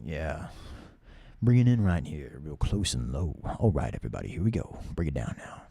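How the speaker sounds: close-mic, deep radio voice; hammed-up smooth low DJ; pushed-low announcer voice. Shown as a demonstration. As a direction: bring it right down, rich and low. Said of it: "trying to push myself lower".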